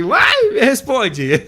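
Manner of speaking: high, loud, drawn-out call; mock son calling mom. Host imitating a son who demands his mother answer, in a high, loud, slow call. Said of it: "chamando a mãe aqui".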